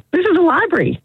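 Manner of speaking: librarian voice. Acted. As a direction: squeak it out like a fussy librarian. Librarian scolds in joke.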